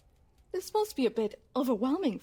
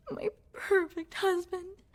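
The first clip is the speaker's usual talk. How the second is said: tearful; 7 semitones higher than usual. Acted. Unhinged love confession.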